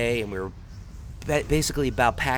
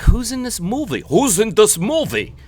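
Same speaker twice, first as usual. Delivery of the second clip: high, loud mock German tourist; imitating excited German friends, German accent; 7 semitones higher, 7 dB louder than usual. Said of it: "my two German friends".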